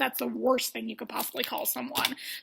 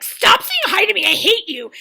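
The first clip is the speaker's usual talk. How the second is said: high, loud shouted rebuke; mock furious outburst; 7 semitones higher, 10 dB louder than usual. Sydney answering her rival's hello, mock furious. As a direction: explode at a friendly greeting. Acting rival snapping at greeting.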